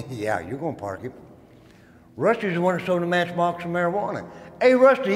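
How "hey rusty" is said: loud, high call across the table. Retold or imitated. Inmate calling Rusty over to sit, loud and high. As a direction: summon him over to the seat.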